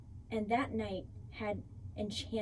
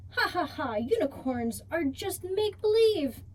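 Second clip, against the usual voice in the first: mocking laugh; 7 semitones higher, 6 dB louder than usual. Friends mocking the girl's belief.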